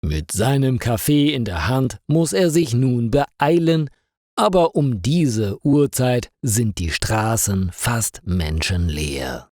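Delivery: ordinary delivery, nothing marked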